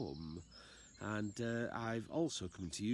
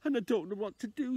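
high, louder anxious plea; pleading man; 8 semitones higher, 4 dB louder than usual. Acted. Unfit man begs the old lady downstairs, high anxious call. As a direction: call out to her, worried. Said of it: "He said".

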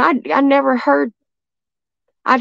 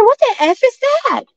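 retold scream, even higher; 11 semitones higher than usual. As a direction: shriek it like you can't believe your eyes. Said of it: "And I screamed it".

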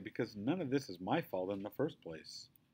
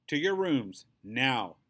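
louder, firm command; stern order; 4 semitones higher, 7 dB louder, slower than usual. A narrator's reading. Mother ordering her sons to their rooms, stern. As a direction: send them off, no arguing. Mom orders boys upstairs.

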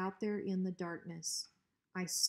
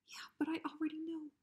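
quick nervous whisper; fast whisper; 8 semitones higher, 4 dB quieter, faster than usual. Acted. Drover whispering his excuse to Hank. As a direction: hurry it out under your breath.

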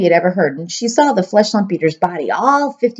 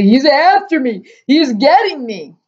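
high, slow, stretched-out cries of terror; screaming in panic; 6 semitones higher, 3 dB louder, slower than usual. A narrator's reading. Thrashing giant dreaming he is attacked, screaming. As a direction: wail it high, dragging out the words. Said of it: "screamed the flesh lump eater".

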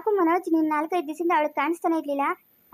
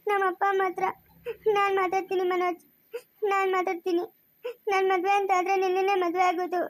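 tearfully insistent; crying, stubborn pleading; 3 semitones higher than usual. Acted. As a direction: vow it through sobs. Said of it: "ಯಾಕೆ ಅಳ್ತಿರೋದು ನೀನು".

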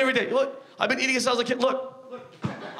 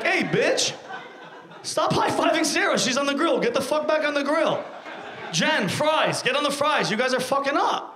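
high-pitched, exasperated telling-off; acted scolding; 5 semitones higher than usual. Impatient customer ordering the kitchen staff back to work, scolding.

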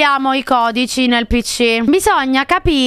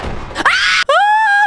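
high, sudden yelp of fright; startled shriek. Real, not acted. Streamer spooked by the game, startled shriek. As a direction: shriek at a jump scare.

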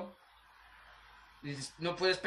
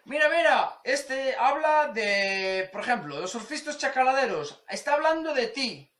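high, gleeful stirring-up voice; mocking friend; 5 semitones higher, 3 dB louder than usual. A friend stirring trouble, telling him the video is about him. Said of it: "un amigo cabrón, que te dice".

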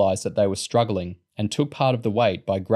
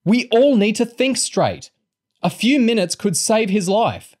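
high, loud, urgent plea; urgent scream; 12 semitones higher, 5 dB louder than usual. A narrator's reading. Girl rallying squabbling friends in a crisis, screaming. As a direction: shout urgently over the chaos. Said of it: "she screamed".

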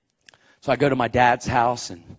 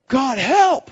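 plea to God; 12 semitones higher, 7 dB louder than usual. Retold or imitated.

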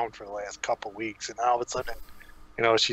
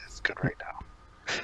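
whisper; 8 dB quieter, slower than usual. Acted. Conspiratorial aside.

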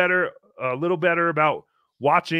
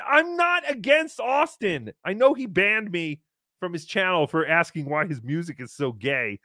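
ordinary delivery

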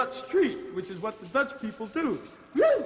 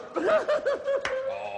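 cackling laugh far higher; high cackle. Acted. Griselda laughs at own joke.

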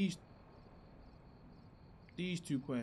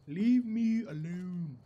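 loud, slow, stretched words; drawn-out plea; 6 dB louder, much slower than usual. Real, not acted. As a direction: stretch out "Leave me alone" loudly. Playfully telling pursuer off.

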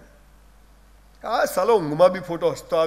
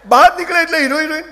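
punchline; 7 semitones higher, 6 dB louder than usual. Real, not acted. Repeated punchline, louder.

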